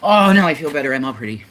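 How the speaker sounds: loud, high-pitched burst dropping off; mock preening. Preening for the stream, loud mock vanity. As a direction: preen out loud with a big swooping start. Showing off to the viewers.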